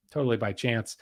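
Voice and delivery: "totally by chance" dropped low and soft; dry sarcastic mutter. Acted. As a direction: murmur it as an ironic aside, knowing. Sarcastic repeat after "I'm sure.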